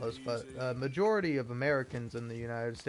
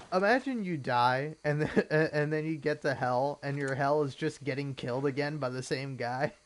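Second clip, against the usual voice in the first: amused, breaking into laughs mid-sentence; talking through bursts of laughter; laughing; 4 dB louder than usual. Real, not acted. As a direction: laugh your way into the thought. Amused by his gator hell joke.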